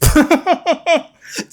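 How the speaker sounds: high, loud put-on giggle; high laugh. [name] teasing Sawyer, high flirty giggle.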